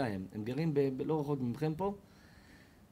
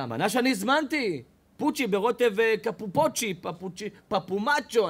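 louder, higher, stumbling over the dish name; comic loud mispronouncing diner; fumbling indignant diner; 6 semitones higher, 7 dB louder than usual. Acted. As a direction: bluster while mangling the word.

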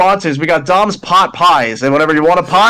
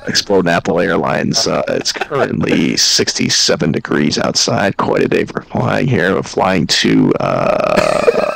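very low, droning pilot announcement; mock pilot with long deep uhs; airline captain; 13 semitones lower, slower than usual. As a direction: drop way down like a captain addressing passengers. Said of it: "you sound like an airline captain".

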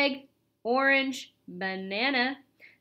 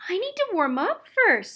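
high, louder squeak; squeaky mouse; 9 semitones higher, 6 dB louder than usual. A narrator's reading. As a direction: squeak it like a worried little mouse. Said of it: "squeaked Ralph".

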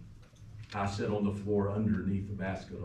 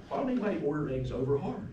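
higher, quick, puzzled question; retold baffled question, rattled off; incredulous; 6 semitones higher, faster than usual. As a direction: ask it in mock disbelief, pitch lifted. Mock disbelief at friend's breakfast order.

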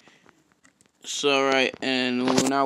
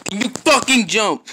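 loud, higher furious demand; furious shout at the game, much louder; angry yell; 9 semitones higher, 11 dB louder than usual. Real, not acted. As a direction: yell at the character to jump. Yelling at the game.

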